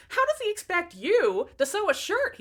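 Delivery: high, loud mock wail; hammed-up bawling. A narrator's reading. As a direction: whine it out in mock despair. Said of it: "he bawled".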